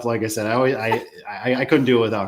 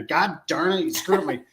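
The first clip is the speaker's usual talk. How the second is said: higher-pitched imagined grumble; mock exasperation; 5 semitones higher than usual. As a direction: play the annoyed listener, pitched up. Imagines Julio annoyed at his name.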